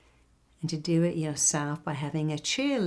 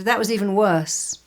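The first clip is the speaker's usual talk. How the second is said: blunt jab; 3 semitones higher, 6 dB louder than usual. Retold or imitated. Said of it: "I went to".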